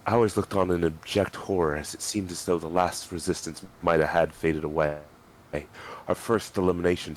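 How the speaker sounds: ordinary delivery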